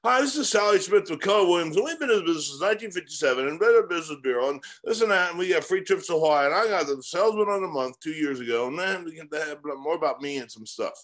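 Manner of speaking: high, breakneck salesman spiel; mock salesperson gabbling without a break; rattled-off sales pitch. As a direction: rattle it off like a canned cold-call script. Parody of a salesman's rambling pitch.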